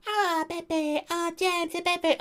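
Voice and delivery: put-on very high squeaky baby voice. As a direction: squeak the names like a toddler. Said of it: "I don't mean like".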